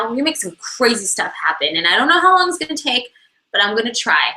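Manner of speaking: ordinary talk, nothing marked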